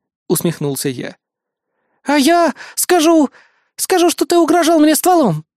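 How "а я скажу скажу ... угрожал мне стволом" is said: defiant threat. A narrator's reading. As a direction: counter him stubbornly, pitched way up. Woman stubbornly escalates her threat.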